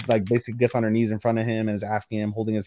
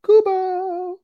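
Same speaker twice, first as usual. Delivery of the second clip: high, loud, drawn-out call; called-out name; 5 dB louder than usual. Acted. Answering who meets him, called-out name. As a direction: sing the name out high and long.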